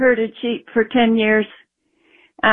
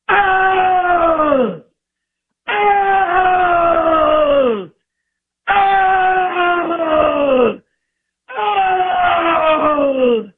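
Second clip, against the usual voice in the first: imitated bear bellow; 7 semitones higher, much slower than usual. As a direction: bellow each Oh like a bear attacking. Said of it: "because I heard him go".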